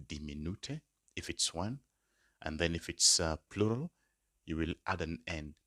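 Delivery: ordinary talk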